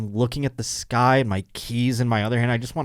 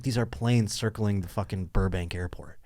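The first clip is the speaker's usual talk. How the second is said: deadpan aside; 3 semitones lower, 7 dB quieter than usual. Retold or imitated. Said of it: "I'm like".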